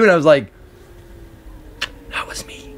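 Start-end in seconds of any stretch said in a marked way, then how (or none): 2.1-2.7 tiny whispered confession; hushed, embarrassed admission; sheepish whisper. Retold or imitated. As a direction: whisper it as if caught out.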